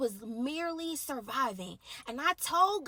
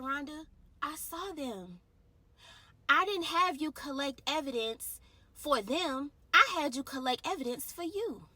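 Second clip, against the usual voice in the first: voice of God; 4 semitones higher, 7 dB louder than usual. Retold or imitated. Voicing God's words, building up.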